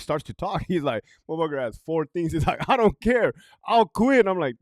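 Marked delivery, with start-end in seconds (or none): 2.7-4.3 louder, higher defiant quit; the chief daring them, loud and sure. Retold or imitated. Retelling the police chief's defiant vow, loud.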